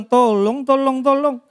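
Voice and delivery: mock call for help, sing-song and unurgent; half-hearted plea. Shown as a demonstration. Preacher mimicking someone in a pit, implausibly mild plea. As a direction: call for help without any real alarm. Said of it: "nggak mungkin dia bilang".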